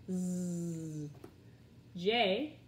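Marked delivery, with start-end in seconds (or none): 0.1-1.1 Z as a long low buzz "zzz"; letter sound. Shown as a demonstration. Teacher drilling letter sounds, Z as "zzz". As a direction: buzz Z without a vowel.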